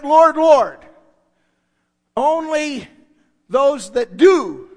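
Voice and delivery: "Lord, Lord" called loud, "those that do" slow and hard; loud emphasis. Real, not acted. Preacher warning the church, loud and slow. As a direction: hammer "those that do" slowly. Preacher driving home the point.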